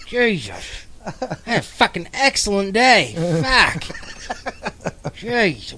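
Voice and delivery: hammed-up angry Mainer griping about a great day; pissed about a good day. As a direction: snarl praise like it's an insult. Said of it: "Pissed right off about a good day".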